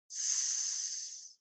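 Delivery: unvoiced whisper of the letter; soft whispered S. Shown as a demonstration. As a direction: breathe the S out without voice. Teacher spelling, whispered letter.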